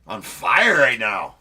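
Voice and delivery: loud, drawn-out celebration; slow loud hype. Real, not acted. Host hyping Craig's safe landing, loud and slow. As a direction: stretch every word in triumph.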